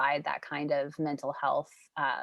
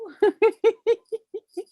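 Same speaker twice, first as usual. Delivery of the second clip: giggling; 8 dB louder than usual. Real, not acted. Puppy on camera.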